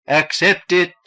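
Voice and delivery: high, loud group cry; cried out. A narrator's reading. Cultists answering their leader, loud high cry. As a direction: call it back in unison. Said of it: "cried the others".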